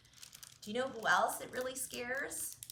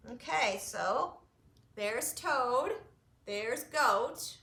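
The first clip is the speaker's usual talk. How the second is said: ordinary delivery, nothing marked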